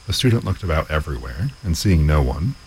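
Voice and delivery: usual speaking voice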